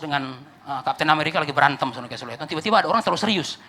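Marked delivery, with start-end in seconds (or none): none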